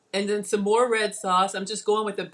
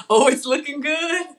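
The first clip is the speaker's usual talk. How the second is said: higher, louder, gleeful anticipation; excited; 5 semitones higher, 7 dB louder than usual. Real, not acted. Cook watching the cheese go on, excited. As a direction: build to a delighted exclamation. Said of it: "I'm getting excited".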